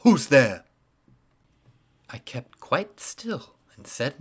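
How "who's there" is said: startled loud cry into the dark. A narrator's reading. Old man bolting up in bed, calling out in fright. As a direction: shout it, sudden and afraid. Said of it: "crying out".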